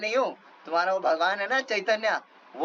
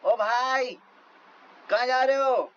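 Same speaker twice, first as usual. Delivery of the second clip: mock yell after them; 5 semitones higher, slower than usual. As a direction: shout down the road at the fleeing pair. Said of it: "ये लोग चिल्लाते रहे".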